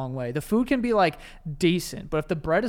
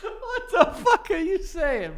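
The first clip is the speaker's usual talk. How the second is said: laughing; 13 semitones higher, slower than usual. Real, not acted. Laughing at his friend.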